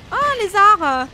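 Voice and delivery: sudden high, loud startled cry; startled shout. Real, not acted. Surprised by a lizard, shouting out loud.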